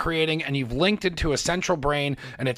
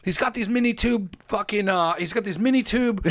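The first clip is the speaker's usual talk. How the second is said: higher pitched, worked-up rant to a friend; fired-up rant; 6 semitones higher than usual. Retold or imitated. Calling Gina from the car, higher and all worked up. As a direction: blurt it all out, fired up and swearing. Retelling excited call to Gina.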